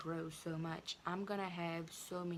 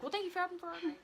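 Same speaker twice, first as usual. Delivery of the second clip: mock polite; 12 semitones higher, 5 dB louder, much faster than usual. Mock polite exit from principal.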